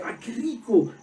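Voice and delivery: high imitation of delight; delighted exclamation. Preacher imitating a grateful friend, delighted. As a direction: gush over the gift. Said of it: "Siempre dice".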